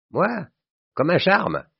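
high-pitched, beaming triumph; triumphant. A narrator's reading. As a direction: boast with a triumphant smile in the voice. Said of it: "Son sourire chantait le triomphe". (triomphant, tout sourire)

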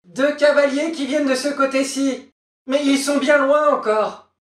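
very high, excited call; sister on lookout. Acted. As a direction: announce a sighting from afar. Sister reports riders from tower.

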